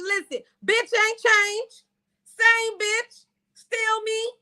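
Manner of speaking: high, drawn-out sassy comeback; defiant retort. Acted. Woman reminding her angry man she hasn't changed, defiant. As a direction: stretch it out in defiance.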